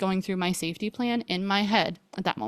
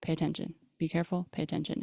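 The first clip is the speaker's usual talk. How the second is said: intuitive warning; 2 semitones lower, 9 dB quieter, faster than usual. Retold or imitated. Intuition cautioning her before she drives out, hushed. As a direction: repeat it gently under your voice. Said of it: "getting intuitive feeling".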